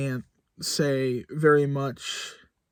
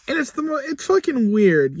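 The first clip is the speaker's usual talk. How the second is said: louder, higher, leaning hard on fucking; emphatic disbelief; 11 semitones higher, 5 dB louder than usual. Real, not acted. Vlogger baffled by his video's views, emphatic and louder. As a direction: push the fucking, genuinely baffled. Reacting to odd view counts.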